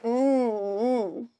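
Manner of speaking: a contented tasting hum; happy tummy-rubbing mmm; yummy mm. Shown as a demonstration. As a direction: hum as if the jam tastes delicious. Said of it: "you can rub his tummy and you can go".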